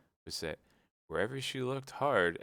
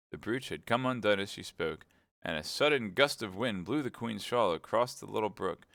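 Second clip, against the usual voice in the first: ordinary delivery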